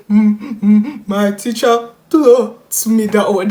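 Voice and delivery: loud, slow, hammed-up sobbing stutter; stuttering hiccup cry. Crying child tells mom the truth, hammed-up hiccuping sobs. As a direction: break the words up with mock crying hiccups. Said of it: "doing that weird cry, stutter, hiccup noise".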